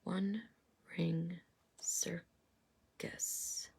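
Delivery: hushed, whispered card name. Real, not acted. Tarot reader rereading the card name to viewers, whispered. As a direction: murmur it almost inaudibly, savouring it.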